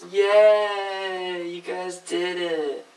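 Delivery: high, drawn-out "yay"; playful cheer. Pretend. Teacher praises viewers for finishing experiment, playful cheer. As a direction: stretch it out in a high congratulating voice.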